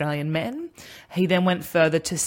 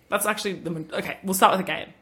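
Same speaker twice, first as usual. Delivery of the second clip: ordinary delivery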